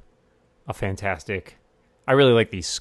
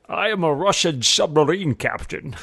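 higher, hammed-up Russian captain voice; mock Russian accent; 7 semitones higher, 4 dB louder than usual. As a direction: play a cartoon Russian sub captain. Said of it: "as we used to say".

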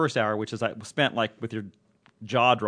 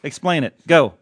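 loud, higher playful order to explain cricket; mock command; 4 semitones higher, 9 dB louder than usual. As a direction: bark it like a joking coach putting Colin on the spot. Jokingly commands Colin to explain cricket.

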